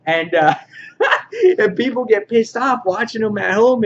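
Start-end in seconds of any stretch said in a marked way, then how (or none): 0.6-1.5 high burst of real laughter; amused laugh. Real, not acted. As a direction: laugh out loud at the story. Laughing at his story.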